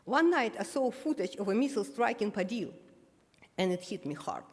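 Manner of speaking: ordinary delivery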